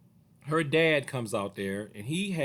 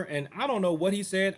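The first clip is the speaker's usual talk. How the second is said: usual speaking voice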